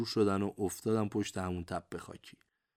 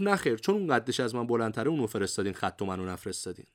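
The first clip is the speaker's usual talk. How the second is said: plain reading voice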